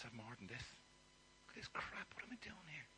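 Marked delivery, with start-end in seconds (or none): 1.5-2.1 whisper. Acted. Son muttering to himself. 2.1-2.9 soft, lost question; quiet despair. Acted. Hungry son among pigs, softly despairing.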